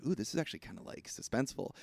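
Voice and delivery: hushed, quiet inner voice; whispered aside. Retold or imitated. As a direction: breathe it like a secret thought. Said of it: "which I'm like".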